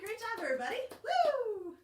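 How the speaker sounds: high, loud whoop; cheering. Real, not acted. Teacher whooping after the story ends. Praising children.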